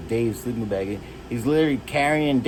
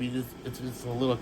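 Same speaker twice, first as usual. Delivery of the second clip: ordinary talk, nothing marked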